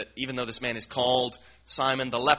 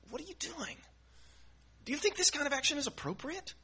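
high, softer scolding voice; mock scolding; 11 semitones higher, 10 dB quieter, faster than usual. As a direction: play the critics scolding her. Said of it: "They're scolding this woman".

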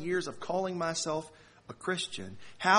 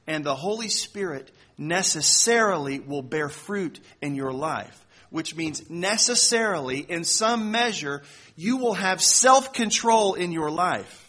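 preaching; 4 semitones higher, 9 dB louder than usual. Acted. Preaching builds to full force.

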